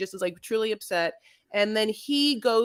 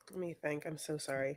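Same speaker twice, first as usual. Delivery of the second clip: sheepish murmur; 8 semitones lower, 17 dB quieter than usual. Real, not acted. Host apologizing, fumbling her notes.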